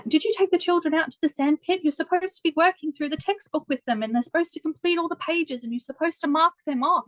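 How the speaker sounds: high, sharp scolding in a put-on teacher voice; raging prac teacher. Prac teacher scolding the student teacher over the sand pit, mock raging. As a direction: act out the furious teacher telling you off. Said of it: "absolutely raging at me going".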